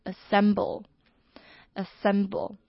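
"assemble" slowly, weak first A, stress on "-sem-"; teacher modelling "assemble"; careful classroom "assemble"; slow model pronunciation. Shown as a demonstration. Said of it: "重音在S S E M这边".

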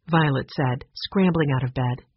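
ordinary delivery

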